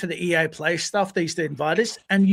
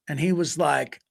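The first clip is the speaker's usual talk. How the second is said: normal talking voice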